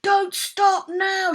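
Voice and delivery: high, loud, slow shout; Dad shouting to keep going. A narrator's reading. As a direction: shout it over the wind. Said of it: "shouted Dad".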